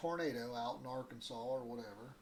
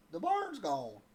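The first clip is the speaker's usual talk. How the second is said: a little country twang, a bit higher and louder; country twang quote; 5 semitones higher, 6 dB louder, slower than usual. Retold or imitated. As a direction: say it like the lady from Arkansas, with a twang. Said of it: "she said".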